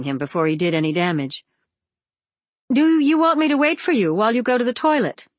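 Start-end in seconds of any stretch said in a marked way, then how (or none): 2.7-5.3 high, quick nervous question. A narrator's reading. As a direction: ask it anxiously and fast. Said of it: "Lana asked nervously".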